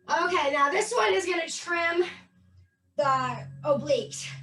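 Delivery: normal talking voice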